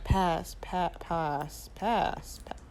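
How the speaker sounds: muttering pass over and over, unsure which vowel; trying out pronunciations. Retold or imitated. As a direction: pass and pa- softly alternated, flat A against broad A. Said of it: "in my head".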